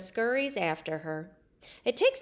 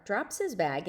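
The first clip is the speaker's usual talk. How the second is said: ordinary delivery, nothing marked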